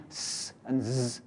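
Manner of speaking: "s" and "z" each held long as hiss and buzz; extended sounds. Shown as a demonstration. Teacher showing the exercise, "s" and "z" drawn out. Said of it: "just making extended".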